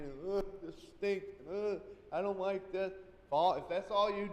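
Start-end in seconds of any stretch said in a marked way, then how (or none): 0.3-2.9 mock complaining. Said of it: "sit and complain".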